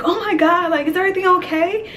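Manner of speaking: high-pitched, mock-concerned girl; alarmed concern imitation. Voicing a concerned girl in story.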